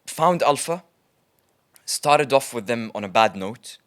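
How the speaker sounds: usual speaking voice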